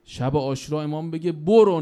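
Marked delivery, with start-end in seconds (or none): 1.3-1.8 sudden loud cry of go; commanding shout. Acted. Imam sending companion away, loud command. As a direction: cry out the dismissal with force.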